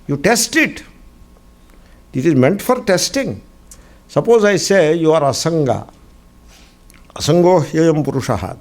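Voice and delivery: normal talking voice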